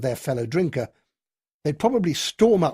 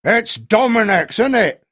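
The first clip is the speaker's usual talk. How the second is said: loud, higher and slow; shouted accusation; 7 semitones higher, 8 dB louder than usual. A narrator's reading. Red-faced drinker shouts at the colonel, loud. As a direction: hurl the guess at him. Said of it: "shouted the red-faced fellow".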